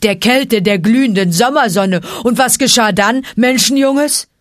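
loud sarcasm, rising in pitch at the end; scornful rasp. A narrator's reading. Bear challenging the man-cub's story, scornful rasp. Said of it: "schnarrte Baloo".

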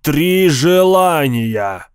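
slow, drawn-out genie roar. Acted. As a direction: roar it out with glee. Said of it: "проревел Крезераст". (громкий рёв джинна)

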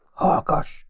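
urgent hushed whisper, no voice at all. A narrator's reading. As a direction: hiss it so no one overhears. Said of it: "súgta a detektív". (sürgető suttogás)

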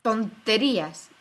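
loud, drawn-out firm interruption; firm, forceful dismissal; firm and decided. A narrator's reading. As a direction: cut in with total conviction. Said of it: "atajándole con voz firme y decidida".

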